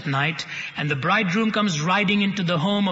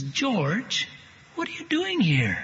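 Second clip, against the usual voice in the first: high, startled cry of disbelief; shocked mother; 4 semitones higher, slower than usual. Acted. Mother opening the door to George, who was believed dead, shocked and high. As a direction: gasp it out as if seeing a ghost.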